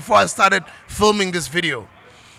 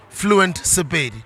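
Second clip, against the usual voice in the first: ordinary talk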